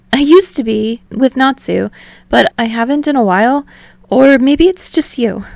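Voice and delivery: a lifted, confiding Hinata voice; lightly voiced Hinata. A narrator's reading. Hinata admitting to Tsukishima it may be him, lightly voiced.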